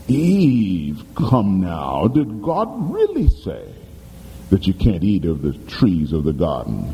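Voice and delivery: low coaxing serpent; the devil sweetly doubting God's word; sly serpent. Acted. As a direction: tempt her with a low coaxing question. Serpent questioning Eve.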